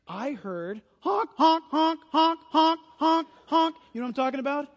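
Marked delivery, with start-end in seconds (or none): 1.0-3.8 higher, louder goose honking; goose honks. Retold or imitated. Pastor imitating geese in the backyard, honking. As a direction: honk like geese overhead.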